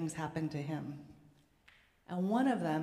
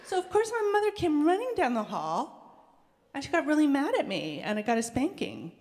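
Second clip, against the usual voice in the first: excited storytelling; 8 semitones higher, 6 dB louder than usual. Real, not acted. Excited retelling of mother's anger.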